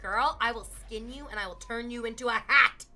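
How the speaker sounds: loud mock menacing threat to the dog. Owner loudly threatening the barking dog in jest. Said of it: "I turned to her and I was like".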